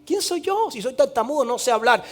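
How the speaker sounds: small, pleading voice of Moses. Acted. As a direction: shrink back like a frightened man. Moses objecting to God.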